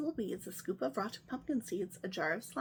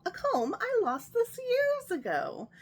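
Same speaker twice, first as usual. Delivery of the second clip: high, loud, drawn-out delight; 12 semitones higher, 4 dB louder than usual. Acted. Witch finding her old comb, high delighted gush. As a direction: gush over the lost treasure.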